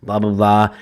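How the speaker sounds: normal talking voice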